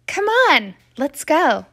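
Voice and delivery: usual speaking voice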